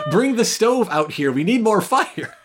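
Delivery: high, loud pretend desperation; hammed-up frantic shouting; mock panic. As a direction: yell orders in comic panic. Imagined panicking Archie wants more fire.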